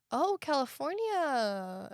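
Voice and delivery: drawn-out, slow condescending "Oh, California". Retold or imitated. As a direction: say it like you look down on the place. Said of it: "that very condescending tone".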